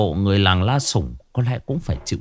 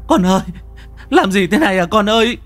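panicked wail; 10 semitones higher than usual. A narrator's reading. Mother wails in panic over her bleeding son, desperate high cry. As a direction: howl in panic for her child. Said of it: "Xuân gào lên hoảng loạn".

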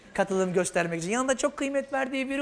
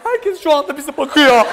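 loud acted scolding; embarrassed outburst; 3 semitones higher, 9 dB louder than usual. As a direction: scold your partner at full volume. (utançla bağırarak azarlama)

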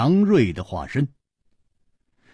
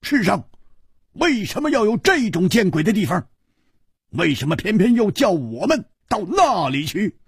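much higher furious roar at the desert; enraged friend cursing the desert, roaring high; 12 semitones higher than usual. A narrator's reading. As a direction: bellow your rage at the cursed place. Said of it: "大吼着说".

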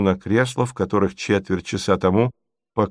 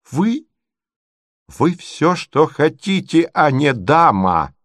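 high, slow, scornful exclamation; thunderous indignation, not quite shouted; outraged cry; 5 semitones higher, slower than usual. A narrator's reading. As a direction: pronounce the insult slowly, pitch raised. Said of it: "вскричал Мозгляков".

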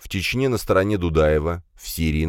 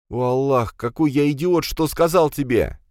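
anguished self-reproach; 8 semitones higher than usual. Acted. Commissar blames himself.

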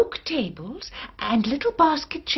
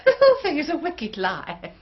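scornful laughing denial; 6 semitones higher, faster than usual. Acted. Accused laughs off the charge.

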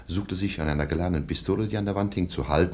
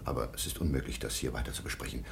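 hushed; 7 semitones lower, 10 dB quieter, faster than usual. Acted. Kaspar present, topic must stop.

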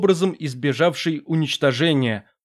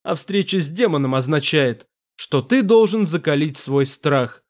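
ordinary delivery

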